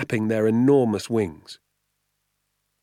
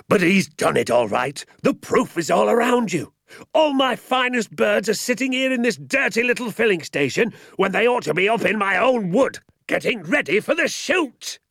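higher, louder, outraged landowner; furious rant; 13 semitones higher, 4 dB louder than usual. A narrator's reading. Mister Hazel rages about his stolen birds, shouting high. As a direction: spit it out like hot lava.

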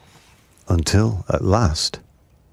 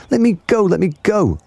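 panicked crocodile; 13 semitones higher than usual. Acted. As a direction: shriek it, helpless and upside down. Crocodile dangling upside down.

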